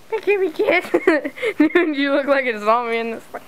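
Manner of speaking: usual speaking voice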